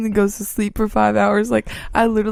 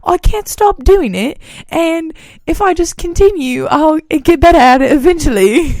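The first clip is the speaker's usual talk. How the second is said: overdone mock British accent, pitched up and louder; ugly British accent; 7 semitones higher, 6 dB louder than usual. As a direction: imitate a friend's bad British accent, hammed up. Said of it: "talking in this ugly British accent".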